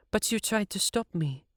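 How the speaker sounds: half-hearted apology to the guard; a light apology. A narrator's reading. Siv excuses herself to guard.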